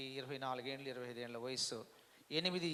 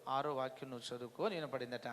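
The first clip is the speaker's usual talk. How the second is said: ordinary talk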